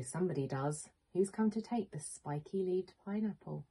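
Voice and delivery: ordinary talk, nothing marked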